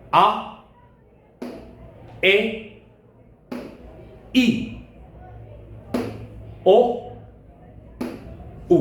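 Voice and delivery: teacher models each Spanish vowel for the class; slow, spaced, crisp Spanish vowels; model vowels to repeat. Shown as a demonstration. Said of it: "Je répète".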